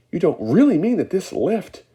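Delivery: put-on high, loud alarmed old man; shouting. A narrator's reading. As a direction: shout in disbelief. Grandpa Joe alarmed.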